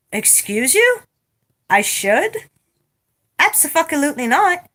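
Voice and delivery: outraged refusal to the nurse; indignant. Retold or imitated. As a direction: scoff at the suggestion.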